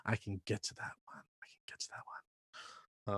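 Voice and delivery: whispered, small, eager to please; meek whisper. Retold or imitated. Meek young employee whispering to herself about a task. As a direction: barely breathe the words, timid. Mimicking meek self under pressure.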